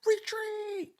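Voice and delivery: very high, strained mock scream; Megatron screaming retreat. Megatron fleeing the Autobots, shrieking high. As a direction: shriek it like a cartoon villain fleeing. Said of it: "Megatron would start screaming".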